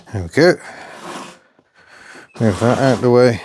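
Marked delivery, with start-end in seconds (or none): none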